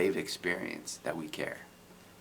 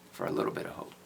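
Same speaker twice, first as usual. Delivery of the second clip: fragile hope; 9 semitones lower, much faster than usual. Retold or imitated. Hesitant hopeful man.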